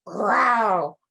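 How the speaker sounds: loud growling roar; bear growl. A narrator's reading. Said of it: "The old bear roared".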